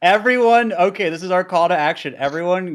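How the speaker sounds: loud announcer-style call; rallying call. Pretend.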